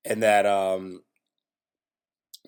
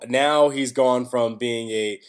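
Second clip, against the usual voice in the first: ordinary delivery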